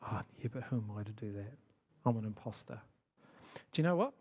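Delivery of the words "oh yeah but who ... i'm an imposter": soft, lower inner voice of doubt; quiet self-doubt. Retold or imitated. Listener imagining their own doubt, soft and low. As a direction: mutter it to yourself, deflated. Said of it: "and then thought".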